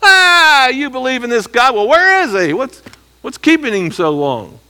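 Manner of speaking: high, loud jeering voice of the scoffing nations; mocking taunt. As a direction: sneer it like a mocker poking fun at a believer. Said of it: "They're mocking".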